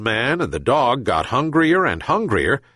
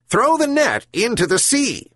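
high gull voice, firm order; commanding herring gull; 12 semitones higher than usual. A narrator's reading. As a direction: call it down like a wise seabird. Gull commands old man.

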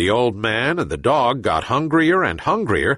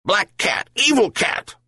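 high, mock-angry yelp on evil cat; the dog warns its master, hammed-up; 10 semitones higher than usual. A narrator's reading. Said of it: "yelped Bully".

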